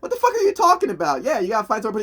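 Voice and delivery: very high, hurried mock outrage; incredulous outburst. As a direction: squeal it in exasperated disbelief. Acted argument between the two.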